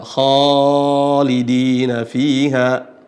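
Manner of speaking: KHALIDINA FIHA chanted slowly with a thick, drawn-out "khaa"; thick, long alif. Shown as a demonstration. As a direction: recite khalidina fiha, stretching a heavy kha. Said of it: "karena huruf sebelumnya adalah huruf tebal". (melantunkan khalidina fiha pelan, kha tebal dan panjang)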